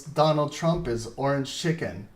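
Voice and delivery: usual speaking voice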